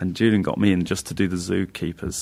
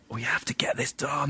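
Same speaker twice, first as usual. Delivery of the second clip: softer whispered panic; stressed whisper; 6 semitones higher, 5 dB quieter than usual. Retold or imitated. Writers panicking before arena tour, stressed whisper.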